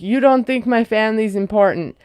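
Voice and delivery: usual speaking voice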